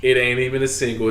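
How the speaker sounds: normal talking voice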